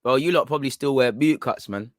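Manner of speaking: ordinary talk, nothing marked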